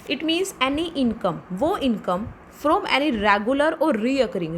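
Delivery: very slow, word by word, as if for notes; slow dictation. Shown as a demonstration. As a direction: dictate each word so students can write. Teacher dictating a definition.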